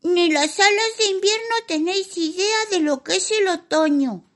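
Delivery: high haughty fairy scolding; winter fairy voice. Acted. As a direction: look down on them, tiny and sharp.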